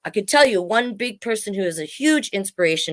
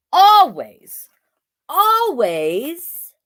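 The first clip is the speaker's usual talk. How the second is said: each always stretched, higher and louder, like a teacher waiting for the answer; always, always, rising and louder, waiting for the reply; drawn-out prompt; 7 semitones higher, 3 dB louder, much slower than usual. Acted. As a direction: coax the class to finish it. Prompting viewers to finish the phrase.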